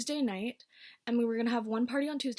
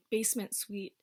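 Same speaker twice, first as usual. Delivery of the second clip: ordinary delivery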